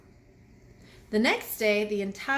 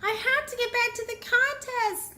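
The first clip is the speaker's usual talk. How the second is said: high, urgent little bee voice; distressed high bee voice; upset cry; 13 semitones higher, 3 dB louder than usual. A narrator's reading. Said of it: "Lizzie cried".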